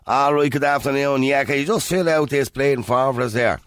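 mock receptionist in a lazy Dublin accent. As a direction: greet a guest in an exaggerated lazy Dublin accent. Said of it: "and the receptionist goes".

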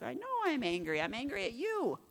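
high-pitched exasperated comeback; mock-petulant admission of anger; retold angry retort. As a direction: act out snapping back at God. Acted angry retort to God.

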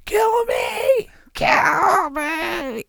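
anguished alien cry, kill me; alien begging to die. Retold or imitated. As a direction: scream like a suffering alien. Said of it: "then it's like".

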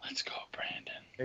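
slow, voiceless whisper; hushed, drawn-out mock-secret whisper. Hushed echo of the catchphrase.

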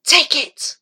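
high, sudden urging; short high cry; urgent plea. A narrator's reading. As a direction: press it on him urgently. Remus urging Odd Boy to take fiddle.

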